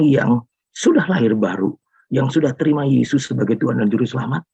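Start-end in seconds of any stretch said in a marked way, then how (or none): none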